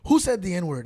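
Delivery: loud, sharp who; angry demand. Retold or imitated. Retelling the furious guard, loud demand. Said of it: "He goes".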